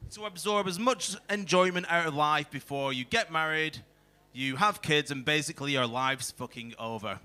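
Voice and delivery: ordinary delivery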